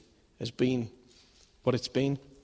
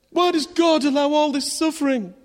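mock indignant skeptic; 11 semitones higher, 6 dB louder than usual. Voicing a skeptic's question.

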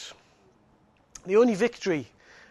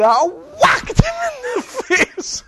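laughing, much higher and louder; 10 dB louder, much slower than usual. Real, not acted. Preacher retelling his childhood fight, laughing hard. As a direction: laugh through the punch line. Laughing while telling the punch.

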